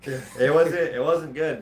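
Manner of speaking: ordinary talk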